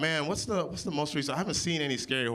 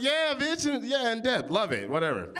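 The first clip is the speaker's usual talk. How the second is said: cocky brag; 8 semitones higher than usual. Retold or imitated. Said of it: "I'm like".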